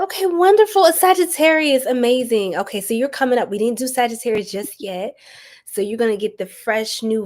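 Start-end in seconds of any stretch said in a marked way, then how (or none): 0.0-2.6 high, loud delighted exclamation; light up at the good news; excited praise. Real, not acted. Reader delighting in a viewer's booking, excitedly.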